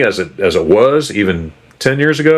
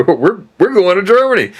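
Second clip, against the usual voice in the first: excited declaration; 11 semitones higher than usual. Retold or imitated.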